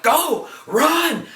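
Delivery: high-pitched mock urgent order; urgent retold command. Fighter urging his companions to escape, urgent. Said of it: "He's like".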